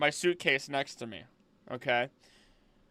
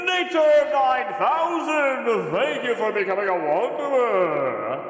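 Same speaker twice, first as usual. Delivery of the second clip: much higher and louder, stretching the name out slowly; excited thank-you shout; 9 semitones higher, 8 dB louder, slower than usual. Real, not acted. Streamer loudly thanking Nature for joining, drawn-out and excited. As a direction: announce the new member like a big celebration. Thanking a new channel member.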